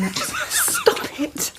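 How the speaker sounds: giggling protest; laughing stop it. Acted. Woman caught dancing with doctor, laughing. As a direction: squeal it through a laugh.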